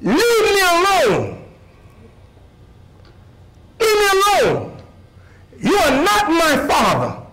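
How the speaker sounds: put-on high-pitched, stubborn child pushing back; lightly voiced girl refusing a stranger; defiant little girl. Girl tells the man walking her to school, high defiant protest. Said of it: "She said".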